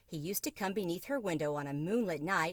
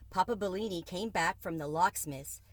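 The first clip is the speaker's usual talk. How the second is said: ordinary delivery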